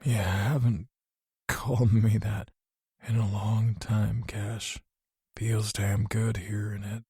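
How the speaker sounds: faint, slow, fading voice; weak grumble. Acted. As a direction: barely get it out. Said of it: "he weakly grumbled".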